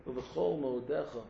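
usual speaking voice

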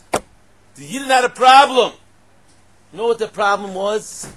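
very loud, high, drawn-out cry; sudden high-pitched holler; preaching shout. Acted. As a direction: boom it out to the whole room. Preacher suddenly booms out the problem.

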